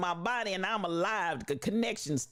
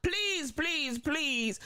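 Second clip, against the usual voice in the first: opening please pitched much higher, then easing into pleading; 8 semitones higher, much slower than usual. Retold or imitated. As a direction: plead with the crowd in soul-singer style. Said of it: "I feel the begging spirit of [name]".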